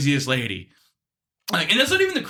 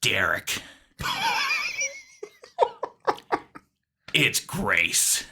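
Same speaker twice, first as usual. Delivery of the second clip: solemn caller; much slower than usual. Retold or imitated. Imitates caller announcing herself.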